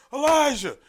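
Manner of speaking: high, very loud name call; commanding high-pitched shout; imitating her yelling. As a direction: holler the name across the room. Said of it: "it's him she's yelling at".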